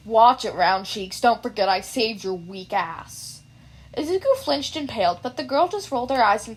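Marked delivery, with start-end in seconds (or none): 0.1-3.3 low, slow snarl; mild snarling bully; snarled threat. Acted. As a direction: snarl it at her. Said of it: "glared at her and snarled".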